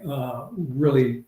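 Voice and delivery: ordinary delivery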